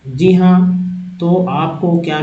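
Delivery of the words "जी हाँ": normal talking voice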